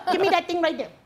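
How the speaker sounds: the class clown mocking the sub, Filipino accent; Filipino accent, laughing. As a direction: mimic the sub through giggles. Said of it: "she'd go like this".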